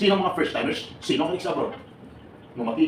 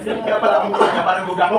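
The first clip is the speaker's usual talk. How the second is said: louder, laughing through a punchline; 4 semitones higher, 7 dB louder than usual. Real, not acted. Speaker retelling applicant's answer to the crowd, laughing hard. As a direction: crack up while telling it.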